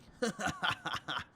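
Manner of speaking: laughing. Acted. Character laughs at own line.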